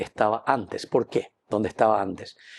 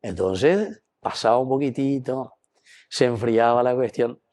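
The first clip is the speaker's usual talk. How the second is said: ordinary talk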